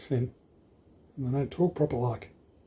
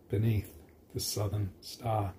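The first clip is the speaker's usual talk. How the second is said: soft slow verse ending; 4 semitones lower, 8 dB quieter than usual. Acted. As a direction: drop much softer and slow down. Verse ending read softly.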